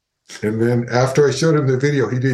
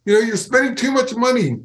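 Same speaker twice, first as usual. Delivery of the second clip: mock angry boss; 12 semitones higher than usual. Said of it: "It's like".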